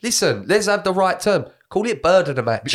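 ramp it up, jokey; excited mock rant. Joking rant on names.

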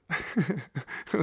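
high laugh mid-story; burst of laughter. Real, not acted. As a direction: laugh at the punchline. Laughing at his own story.

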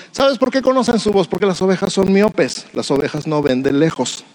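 ordinary delivery

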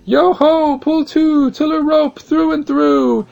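high, loud rowing chant; boatman's shout. Acted. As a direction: bellow it across the water. Ferryman calls out while rowing.

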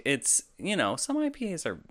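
ordinary talk

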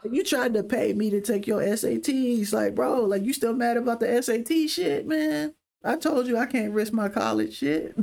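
exasperated protest. Retold or imitated. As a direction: retell it as an exasperated comeback to a friend. Retold argument with homie over SATs.

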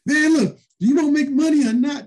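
high, loud push to take the deal; Jesse pressing hard. Retold or imitated. Jesse pushes the young wrestler, high and loud. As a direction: insist he take the money. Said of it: "There's no way in the world".

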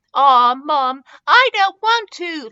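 put-on high, loud child's whine; high-pitched, put-upon whine; whining. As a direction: protest like a whiny little kid. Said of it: "Leo whined".

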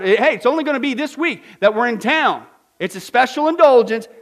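loud, high auction patter; auctioneer. Retold or imitated. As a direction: sell it like an auctioneer. Pardoner auctioning forgiveness prices.

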